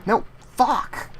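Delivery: high, sharp angry outburst; frustrated cry. Real, not acted. Losing progress in the game, real frustrated yelp.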